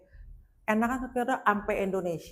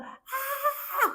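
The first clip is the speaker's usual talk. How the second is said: high-pitched mock shriek; piercing retold cry of alarm; imitated scream. Tourist falling with her suitcase, mock screaming.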